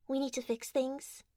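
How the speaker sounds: normal talking voice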